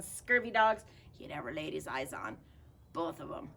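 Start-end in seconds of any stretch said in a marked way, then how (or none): none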